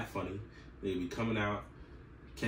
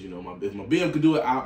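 usual speaking voice

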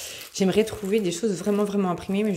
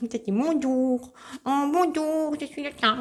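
higher, silly playful voice; playing silly; 7 semitones higher, slower than usual. Pretending to be the cat for viewers, silly higher voice. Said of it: "Je fais la folle".

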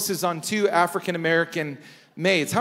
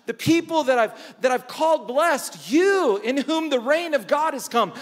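passionate Jesus; 7 semitones higher than usual. Retold or imitated. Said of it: "and he says".

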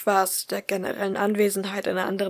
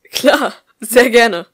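much louder, slow and stretched; too-loud eager yes; 3 semitones higher, 13 dB louder, much slower than usual. A narrator's reading. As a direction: blurt it out too loud with joy. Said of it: "„Schrei doch nicht so".

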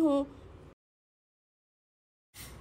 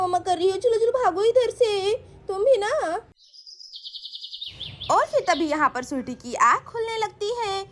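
usual speaking voice

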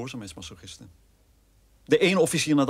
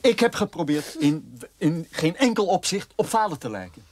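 higher, pleading and indignant; desperate protest; 6 semitones higher than usual. Acted. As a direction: defend yourself, voice climbing. Broer verdedigt zich wanhopig. (wanhopig protesterend, stem schiet omhoog)

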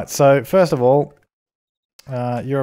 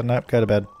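normal talking voice

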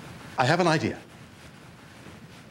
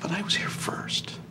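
petulant whisper; 7 semitones higher, 7 dB quieter than usual. Acted. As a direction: hiss it under your breath. Whispered protest in ad.

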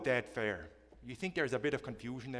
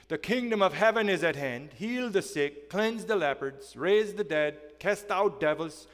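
raised, ringing preacher voice; proclaiming; 6 semitones higher, 6 dB louder than usual. Acted. Pastor proclaiming Jesus' command to the disciples, loud and higher.

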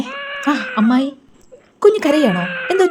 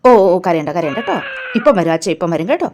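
lower, gentle hushing; reassuring coo to an infant; soothing a baby; 5 semitones lower than usual. Acted. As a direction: comfort a crying baby in a low voice.

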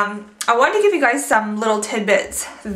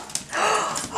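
soft high gasp of delight; quiet high intake of joy; 11 semitones higher, 9 dB quieter than usual. Real, not acted. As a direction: gasp at how cute she is. Delighted on seeing the dog.

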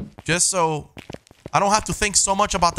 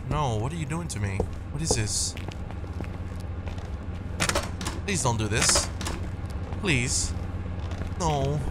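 scared horror game streamer; 8 dB quieter than usual. Real, not acted. Scared streamer in dark house.

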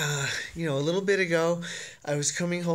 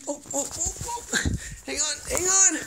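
startled; 11 semitones higher than usual. Real, not acted. Struggling with dog.

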